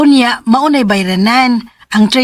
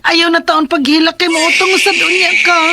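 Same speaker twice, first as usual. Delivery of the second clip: put-on hushing a baby; 6 semitones higher than usual. Mother hushing crying baby. (hinay nga paghilom sa bata)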